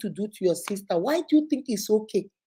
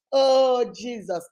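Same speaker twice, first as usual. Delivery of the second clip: usual speaking voice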